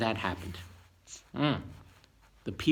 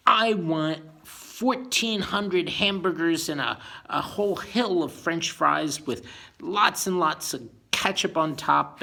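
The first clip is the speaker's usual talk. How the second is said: greedy hunger; 4 semitones higher, 5 dB louder than usual. Pretend. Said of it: "it means".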